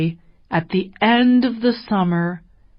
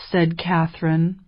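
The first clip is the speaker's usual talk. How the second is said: plain reading voice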